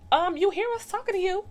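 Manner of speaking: high, loud imitated sass; mock indignant. Lil D to Tamika ignoring friends, mock indignant.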